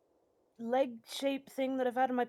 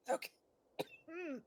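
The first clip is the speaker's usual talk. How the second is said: usual speaking voice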